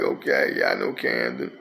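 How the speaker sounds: imitating himself, deep and casual; low mock agreeable reply. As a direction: drop the voice way down and play it cool. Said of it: "I be like".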